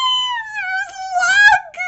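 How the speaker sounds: very high, loud squeal of joy; high-pitched fangirl cry; delighted squeal. Real, not acted. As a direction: shriek happily at spotting a favorite. Spots another favorite character on screen.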